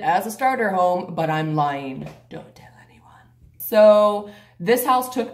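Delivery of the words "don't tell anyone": secretive whisper. Real, not acted.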